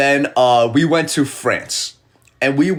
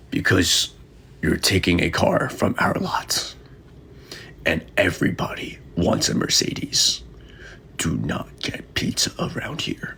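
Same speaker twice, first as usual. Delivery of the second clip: hushed warning; 10 semitones lower, 6 dB quieter than usual. Acted. Acting car rental clerk warning him.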